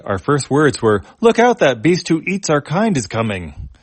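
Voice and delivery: a little mouse recalling its first panicked words; robot mouse Pally. Acted.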